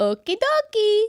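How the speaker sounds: high slow cartoon plumber; Mario voice. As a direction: sing it out like Mario. Imitating Mario line.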